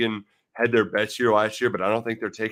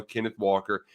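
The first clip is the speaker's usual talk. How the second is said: usual speaking voice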